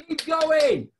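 high, loud shout of encouragement; cheering. Retold or imitated. Fans shouting to the runner each lap, loud cheer.

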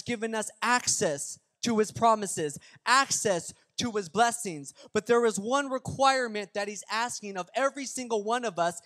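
full-force preaching. Real, not acted. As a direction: drive each point home to the congregation. Preaching the main point.

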